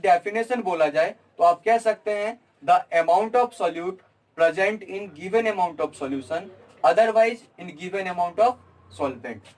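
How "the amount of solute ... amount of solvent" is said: very slow, word by word for note-taking; slow dictation. Shown as a demonstration. Teacher dictating the definition for students to note, very slowly. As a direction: spell it out slowly so they can write it down. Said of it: "तो आप इसे note कर लीजिए".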